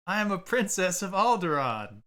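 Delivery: high, loud imitation of a child princess; mock princess proclamation. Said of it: "It's just this scene".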